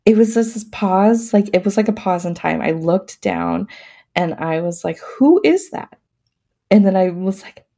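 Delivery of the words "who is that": surprised wonder. Retold or imitated. Retelling first sight of baby.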